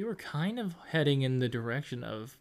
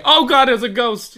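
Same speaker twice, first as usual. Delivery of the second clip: mock panicked shout; 7 semitones higher, 14 dB louder than usual.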